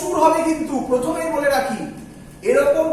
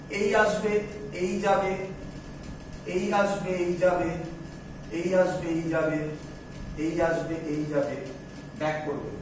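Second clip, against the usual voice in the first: steady repeated phrase, sinking lower and softer line by line; rhythmic fading repetition; 7 semitones lower, 8 dB quieter, slower than usual. Shown as a demonstration. Teacher tracing the current for students, fading to soft and low. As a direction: chant the back and forth, letting it fade away.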